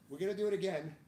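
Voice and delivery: normal talking voice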